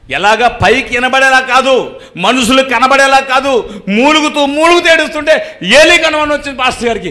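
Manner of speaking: full-force sermon voice; fervent preaching. Acted. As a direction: preach it out to the congregation. Preacher climbs in pitch.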